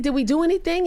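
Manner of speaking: high, alarmed asking; waking up scared, asking what happened; panicked question. Retold or imitated. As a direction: ask in rising panic. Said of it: "And I said".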